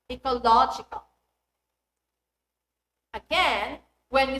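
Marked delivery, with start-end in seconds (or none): 0.1-1.0 ecoLOGical, LOG punched louder; pre-suffix stress. Shown as a demonstration. Teacher showing suffix rule, ecoLOGical stressed before -ical. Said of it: "syllable before these suffixes".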